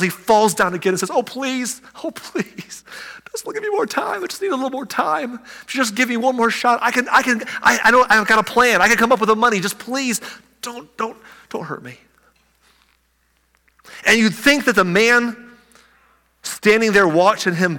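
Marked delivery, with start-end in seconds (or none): 1.1-12.0 high, breaking plea, fading to a near whisper at the end; terrified servant begging his creditor, much higher and pleading; desperate begging. Acted. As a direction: beg for your life, voice climbing high. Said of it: "and begged".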